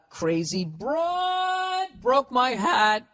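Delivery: high, hugely drawn-out wail; mock melodrama. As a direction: hold "broad" long and high, hammed-up. Mock melodrama reading a letter.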